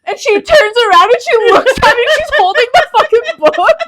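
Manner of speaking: high, loud, laughing shout; real hilarity, voice shooting up; cracking up. Real, not acted. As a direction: barely get the story out for laughing. Telling it while cracking up.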